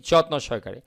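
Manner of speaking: normal talking voice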